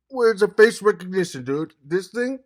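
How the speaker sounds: loud, high-pitched excited question. Real, not acted. Vlogger amazed by his gadget's face recognition, loud excited questioning.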